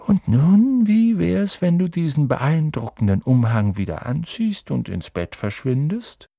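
animated kindly dismissal, starting high; Dumbledore, light suggestion. A narrator's reading. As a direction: lift the voice into a cheery nudge.

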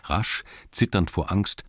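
ordinary delivery

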